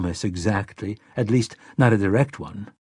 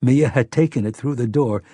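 plain reading voice

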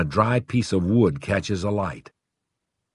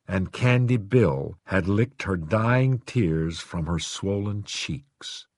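plain reading voice

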